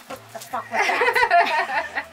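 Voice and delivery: high, loud, slow mock panic through laughter; hammed-up fright at something flying by; startled freakout. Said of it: "my husband thought I was losing my mind".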